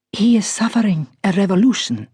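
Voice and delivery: crisp upper-class English with a light Persian R; a hint of a grand Iranian lady speaking posh English; posh Iranian accent. A narrator's reading. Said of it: "in her tremendously posh voice with its just perceptible Iranian R".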